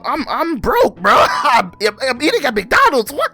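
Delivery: high, loud, laughing indignation; laughing rant. Real, not acted. Vlogger ranting at McDonald's charity ask, high laughing outrage.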